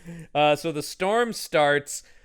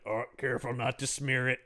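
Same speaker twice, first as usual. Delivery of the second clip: much softer, dainty and careful; fussy murmur; 3 semitones lower, 9 dB quieter than usual. Retold or imitated. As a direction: hush it like applying lipstick. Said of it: "being like".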